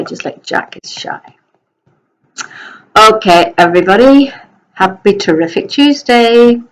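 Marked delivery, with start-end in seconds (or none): 0.0-1.4 breathy whispered tease. Pretend. Telling pupils the dog's shy, whispered. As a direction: drop to a whisper for the punchline.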